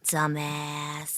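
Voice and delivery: low, soft drawn-out insult; a deep, soft put-down; Jennifer Coolidge impression. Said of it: "it's deep".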